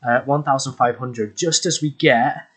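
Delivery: ordinary talk, nothing marked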